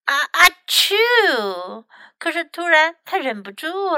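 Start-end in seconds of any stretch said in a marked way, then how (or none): 0.0-1.9 big build-up fake sneeze; slow, drawn-out mock ah-choo; acted sneeze. Fox hiding from Mom can't hold it, acted loud sneeze. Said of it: "打了个喷嚏".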